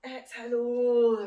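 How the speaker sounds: loud cue. Real, not acted. Instructor cueing the stretch.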